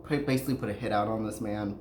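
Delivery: normal talking voice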